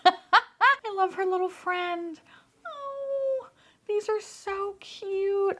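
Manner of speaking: high, slow, doting coo. Real, not acted. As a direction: gush over something tiny and cute. Cooing over toy's friend.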